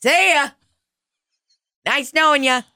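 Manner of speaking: sing-song sarcastic goodbye; sarcastic farewell. Retold or imitated. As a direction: wave them off with fake cheer. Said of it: "And I was like".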